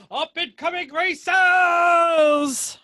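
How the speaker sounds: slow, high, booming announcer; announcer shout. Pretend. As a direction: boom it like a hype man.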